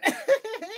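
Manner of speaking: loud, high-pitched giggle; amused cackle. Real, not acted. Joking about being born a witch, high laugh. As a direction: burst out giggling at your own joke.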